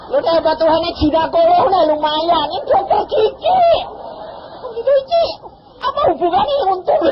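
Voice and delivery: high, loud, incredulous ranting; exasperated rant. Retold or imitated. Husband loudly scoffing that a dentist gave this advice.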